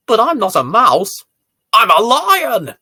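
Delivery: loud, high, bold declaration; proud lion cry. Acted. Girl proudly tells great-grandpa she is a lion, loud and high. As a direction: roar it out like a proud little lion.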